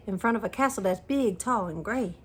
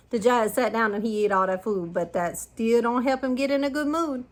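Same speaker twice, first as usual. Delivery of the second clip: usual speaking voice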